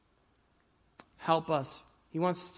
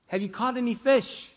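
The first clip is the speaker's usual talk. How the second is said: higher-pitched call from afar; 6 semitones higher than usual. Retold or imitated. Man on shore to fishermen, calling out. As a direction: call out as if to a distant boat. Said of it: "shouting out. He called".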